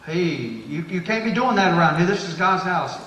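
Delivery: mock stern rebuke. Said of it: "if I just, you know".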